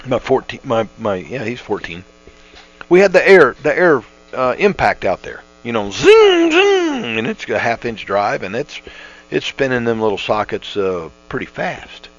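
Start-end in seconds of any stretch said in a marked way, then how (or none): 5.9-7.2 high-pitched imitated tool whine; wrench zing. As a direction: mimic the impact wrench spinning. Imitating the impact wrench noise.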